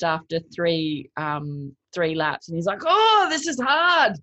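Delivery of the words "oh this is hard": put-on slow, high Irish accent, stretched out. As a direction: imitate an Irish swimmer groaning that it's hard. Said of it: "in his Irish accent".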